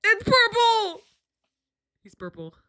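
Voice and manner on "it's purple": high, loud outburst of delight; loud, high excited yell; excited shout. Real, not acted. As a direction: blurt it out with a high-pitched shout. Reacting to reveal of character.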